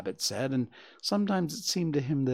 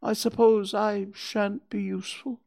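high, forlorn character voice; plaintive, mildly dejected; sad; 8 semitones higher, 4 dB louder than usual. A narrator's reading. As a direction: resign yourself to being left out. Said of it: "said Pooh sadly".

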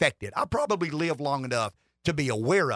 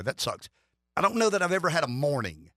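ordinary talk, nothing marked

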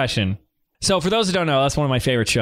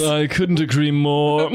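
snooty wine snob; slower than usual. Acted. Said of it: "my impression for you".

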